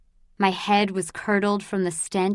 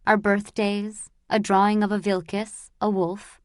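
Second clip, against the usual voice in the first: plain reading voice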